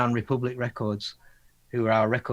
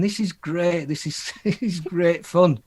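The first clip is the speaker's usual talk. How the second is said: slow words broken by a laugh; 4 semitones higher, slower than usual. Retold or imitated. Band member recalling the joke, laughing. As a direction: laugh through the line.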